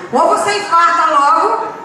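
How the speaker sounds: loud, laughing outburst; mocking shout. Real, not acted. Mocking the threatening mother.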